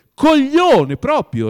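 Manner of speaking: very loud, high, stretched-out insult; furious emphatic outburst; forceful insult. A narrator's reading. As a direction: shout it slowly, full of exasperation. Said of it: "ripeté Oblomov".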